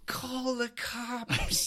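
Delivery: put-on Scottish accent. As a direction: drag it out as a panicky Scottish flatmate. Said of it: "I can't do the accent".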